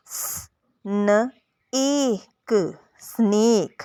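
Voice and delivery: स-, न-, एक, then स्नेक blended, each sound split; sounding out snake. Shown as a demonstration. Teacher sounding out a word for parents. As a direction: break स्नेक into its sounds, then join them. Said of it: "तो अब ये देखें कैसे होगा".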